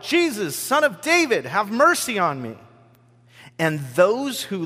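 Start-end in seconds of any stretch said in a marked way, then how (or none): 0.0-2.6 high, loud plea across a crowd; calling out. A narrator's reading. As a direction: cry out to be heard over the crowd. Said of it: "He called out".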